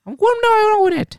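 piercing squeak, very high; squeaky cartoon mouse, cursing; Mickey Mouse voice. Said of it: "I'm gonna do the Mickey Mouse voice".

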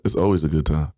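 ordinary talk

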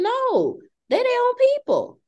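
insist, voice leaping up; emphatic no. Real, not acted. Correcting the group, high insistent pitch. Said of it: "emphatic correction".

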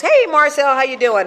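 bright, loud hello across the room; cheery greeting. Retold or imitated. Guy at the meeting greeting Marcel, loud and cheery. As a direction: hail her like an old friend.

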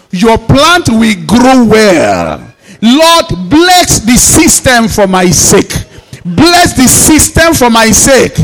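loud, rhythmic prayer leading; preacher calling out blessings over the congregation; fervent prayer. Acted. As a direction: lead the church in declaring prayer.

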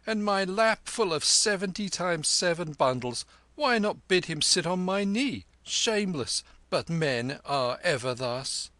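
high, loud peasant wife grumbling; a fussy wife scolding about the crowding; complaining wife. Acted. Wife griping to her husband about the holy man, high and loud.

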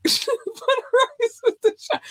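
laughing falsetto echo of the line; high laughing repeat. Pretend. Host cracking up repeating the buttered rice line, squealing high.

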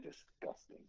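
breathy, soft disgusted whisper. Real, not acted. Disgusted aside.

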